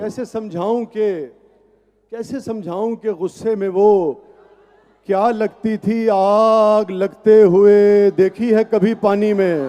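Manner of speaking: measured, emphatic verse recitation; poetry recital. Acted. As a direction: recite it to a live audience. Recites ghazal, half sung.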